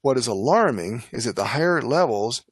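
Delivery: ordinary delivery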